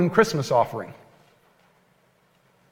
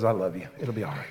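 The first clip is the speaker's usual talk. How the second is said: gentle father; 9 semitones lower, 12 dB quieter, faster than usual. Retold or imitated. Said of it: "like my father would have, just looks down".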